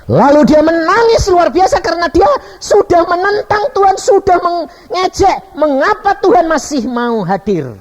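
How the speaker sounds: high, loud, impassioned narration; full-force preaching. Real, not acted. Preacher tells of a sinner's repentance, high and impassioned. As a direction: let it soar over the congregation.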